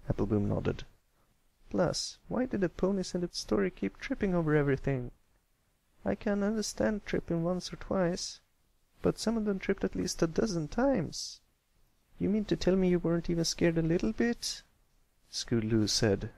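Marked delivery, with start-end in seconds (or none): 1.7-11.4 high country filly, teasing doubt; skeptical filly. Acted. Apple Bloom critiquing the tripping ponies, high. 12.2-14.6 high filly voice, let down; disappointed filly. Acted. Scootaloo disappointed by friends, let down.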